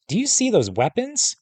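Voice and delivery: high-pitched, exasperated question; incredulous scolding. A narrator's reading. Sister warns brother about the guards' weapons, incredulous. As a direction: push the pitch up in disbelief.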